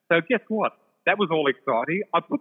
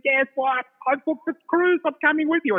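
excited shriek; 13 semitones higher than usual. Retold or imitated. Friend on the phone shrieking that she booked the cruise, excited high shriek. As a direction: shriek the news like a thrilled friend. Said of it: "she's shrieking".

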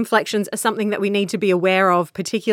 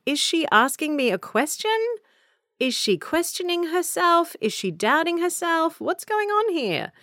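high, softer puzzled questions, lifting at "question" and "herself"; upward inflections; 8 semitones higher, 4 dB quieter than usual. Acted. Listener abroad wondering about the speaker, questioning with upward inflections. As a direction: lift "question", "herself" and "here" like a baffled listener. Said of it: "All of those questions, upward inflections".

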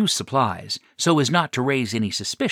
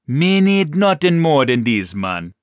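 simple brute; 4 semitones higher, 6 dB louder than usual. A narrator's reading. Pharaoh's caveman talk.

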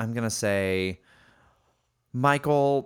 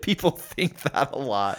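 slow, higher mimicry of a friend; mocking echo; 4 semitones higher, slower than usual. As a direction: parrot it back to tease. Mockingly echoes the co-host's words.

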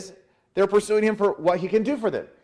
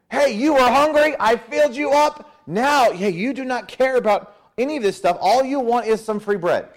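louder, high-pitched challenge to the crowd; exclaiming rebuke; 7 semitones higher, 4 dB louder than usual. Acted. Preacher as Jesus confronting the hungry crowd, loud exclaiming rebuke. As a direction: call them out, voice lifted high.

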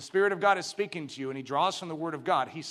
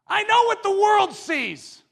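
high, loud, slow cry; impassioned, drawn-out proclamation; shouted preaching; 14 semitones higher, 10 dB louder, slower than usual. Real, not acted. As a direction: belt it out from the pulpit. Preacher shouts contrast to congregation.

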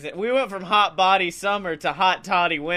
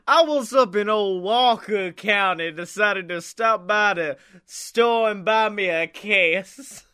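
put-on Southern accent; 4 semitones higher than usual. Mimicking a friend's Walker County trip, playful Southern accent. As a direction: lay on a folksy Southern accent. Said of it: "That's how you talk in Walker County".

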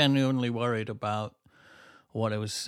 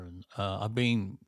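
ordinary talk

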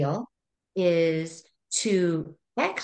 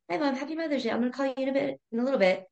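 daughter's text; 9 semitones higher, much faster than usual. Retold or imitated. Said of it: "she texted me and said".

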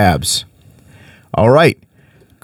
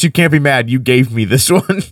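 usual speaking voice

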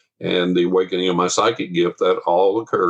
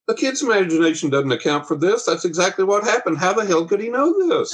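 climbing higher into a laughing near-squeal; rising incredulous insistence; 10 semitones higher than usual. Retold or imitated. Wife overruling the mother's excuse, getting higher and more incredulous. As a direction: pitch it higher with each line until it cracks into a laugh.

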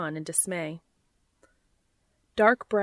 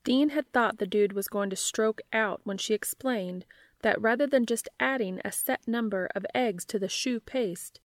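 usual speaking voice

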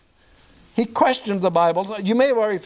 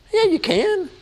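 emphatic rebuttal; 13 semitones higher than usual. Real, not acted. Answering an imagined objection.

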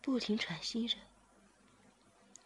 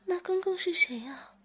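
sudden high, louder frightened question; 11 semitones higher, 5 dB louder than usual. Acted. Zhiming asks master who it was, frightened. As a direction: blurt it out startled and scared. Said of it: "志明马上害怕地说".

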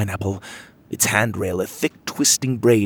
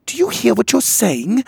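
shocked disbelief; 14 semitones higher, 5 dB louder than usual. Acted. Em shocked at the queen.

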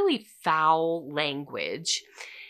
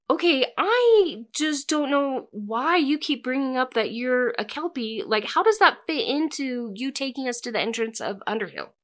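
exasperated; 7 semitones higher than usual. Retold or imitated. Allie exasperated with the kelpie.

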